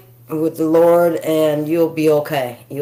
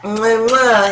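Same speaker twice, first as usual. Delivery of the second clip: between kisses; 4 semitones higher than usual. Real, not acted. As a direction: blow two kisses at the camera. Blowing kisses to viewers.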